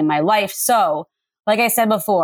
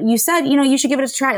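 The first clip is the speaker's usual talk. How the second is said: lightly acted, higher-pitched voice of advice; inner voice replying; 7 semitones higher, much faster than usual. Soul voice answering her own reluctance, lightly acted higher reply. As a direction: answer yourself in a slightly higher voice. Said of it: "text yourself back and forth with the conversation".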